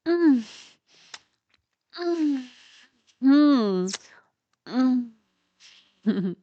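loud closed-mouth moans; muffled moans. Acted. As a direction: moan with the mouth full. Muffled sounds during oral sex.

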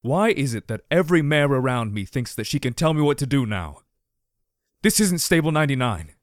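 loud and fed up; angry. A narrator's reading. Angry outburst at mare.

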